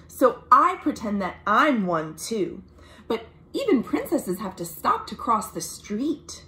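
put-on lively read-aloud for children; story narration. A narrator's reading. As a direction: tell it brightly to young listeners. Reads picture book aloud.

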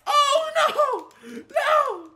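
high, loud laughing cry; laughing panic. Real, not acted. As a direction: squeal through laughter as it slips away. Laughing panic during a match.

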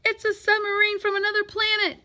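loud, high-pitched alarm; shrieking. A narrator's reading. Coach sighting the giant tadpole, shrieking. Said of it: "shrieked the coach".

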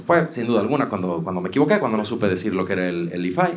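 low, soft, hesitant politician; quiet low deliberation with stumbles; politician impression. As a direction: imitate a statesman groping for his worst mistake. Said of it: "Eh, dice".

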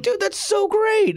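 very high, drawn-out retold cheer; excited congratulations. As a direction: celebrate his news, pitch shooting way up. Retold delight at kid's news.

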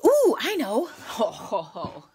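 high, loud excited squeal; goofy excitement. Real, not acted. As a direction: burst out with a sudden idea. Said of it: "I get goofy when I get excited".

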